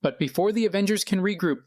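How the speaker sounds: ordinary delivery, nothing marked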